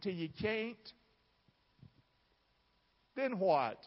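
usual speaking voice

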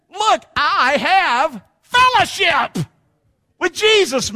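high, slow, drawn-out exclamation; exultant preaching. Real, not acted. Preacher marvelling to the congregation at fellowship with Jesus, exultant. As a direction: proclaim it in amazement, stretching the key words.

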